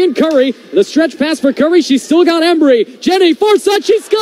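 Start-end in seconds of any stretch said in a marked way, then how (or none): 3.0-4.2 quick high shout of a goal; excited goal call. Real, not acted. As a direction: call the goal out, rising fast. Goal scored in play-by-play.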